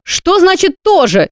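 slow frightened query. A narrator's reading. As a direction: ask it in alarm, drawing it out. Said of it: "испугалась девица".

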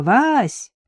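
high call through cupped hands. A narrator's reading. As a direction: holler to someone far away. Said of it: "сложила ладони у рта и крикнула".

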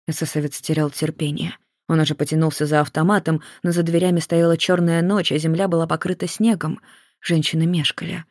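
ordinary delivery, nothing marked

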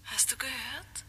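whisper. Acted. Ghostly caller on the phone.

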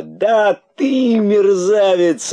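высокий, громкий, протяжный торжествующий крик; кричи радостно, растягивая слова; радостный крик. A narrator's reading. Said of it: "радостно закричал дерзкий кролик". (радостно и дерзко кричит)

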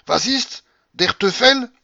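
very high, loud indignation; angry outburst. A narrator's reading. Old soldier rejects the offered coin, angry. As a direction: explode in furious German. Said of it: "me regarda avec colère".

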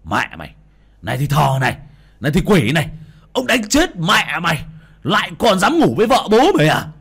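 building to high, loud furious yelling; angry cursing. Acted. Husband cursing as he smashes the shrine, furious yelling. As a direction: curse harder with each blow. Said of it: "miệng chửi".

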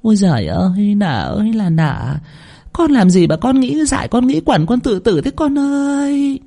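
keen theatrically, stretching each cry; feigned lament. Pretend. Second wife pretending grief for the dead girl, high mock wailing. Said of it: "vờ quỵ xuống mà than thở".

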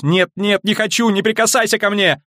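put-on very high, terrified girl; shrill panic, begging to be left alone; panicked cry. A narrator's reading. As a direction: cry out to fend off a touch. Terrified girl screws eyes shut.

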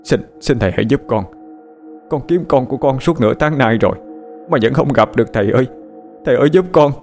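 pleading through choked sobs, rising; fast, desperate sobbing plea. Acted. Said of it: "nói trong tiếng nấc nghẹn".

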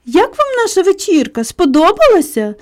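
smug, high-pitched question; self-satisfied. A narrator's reading. Tommy asks the guests about the party, self-satisfied. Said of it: "самовдоволеним тоном спитав Томі".

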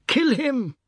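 put-on high, loud boyish urging; shrieked command; shout. A narrator's reading. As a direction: shout it high to egg him on. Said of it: "the god shouted".

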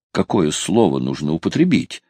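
usual speaking voice

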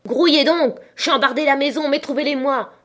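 high, urgent command, loudest at the start; raging ringleader's cry; impatient shouted orders. A narrator's reading. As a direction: hurry them on angrily. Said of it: "réitéra Pieux, en battant la route de sa canne".

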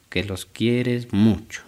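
soft, slow repeating while writing; mock boss writing a letter; slow dictation. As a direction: drag the words out as you write them.

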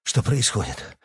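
mild, understated fright; bewildered and scared, faintly; frightened babble. A narrator's reading. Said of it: "испуганно пролепетал Эдуард".

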